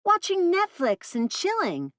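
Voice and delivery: put-on high, slow, knowing teen drawl; sassy teen girl. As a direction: spell it out like a know-it-all. Teen voice on phone.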